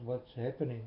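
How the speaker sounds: usual speaking voice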